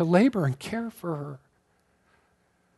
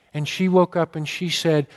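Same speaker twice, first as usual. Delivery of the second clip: ordinary talk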